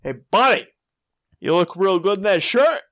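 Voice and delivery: high, swooping teasing call; mock yelling. Man replaying his compliment to a stranger, mock yelling. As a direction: holler the compliment over to a buddy. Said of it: "I thought I was yelling to my friend".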